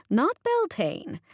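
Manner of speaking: drawn-out teasing dig, pitched high; teasing jab. A narrator's reading. Boss teasing the narrator, playful jab. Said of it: "I smile at her jab".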